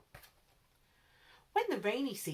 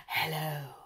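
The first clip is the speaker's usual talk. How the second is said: a lower, hissed hello; hissing snake; 4 semitones lower than usual. A narrator's reading. Snakes hissing a friendly hello to giraffe. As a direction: hiss the greeting like a snake. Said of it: "the snakes would hiss".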